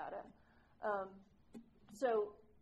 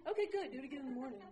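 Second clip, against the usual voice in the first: brisk sponsor; 4 semitones higher, much faster than usual. Retold or imitated. Sponsor's reply voiced.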